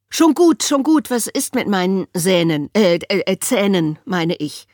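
put-on loud hurried boy, stumbling over Sähnen; a hint of a flustered boy; flustered Alfie. A narrator's reading. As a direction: trip over the word. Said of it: "fragte Alfie".

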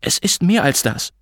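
mother's cutting comeback; hissed anger. A narrator's reading. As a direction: snap back at him without shouting. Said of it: "fauchte Mutter".

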